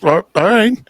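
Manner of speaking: high, stunned okay; mock surprised okay. Stranger demanding he move his truck, and he's dumbfounded. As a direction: play it taken aback and incredulous. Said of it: "was like".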